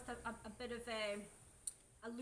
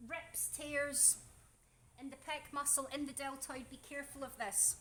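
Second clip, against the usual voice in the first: normal talking voice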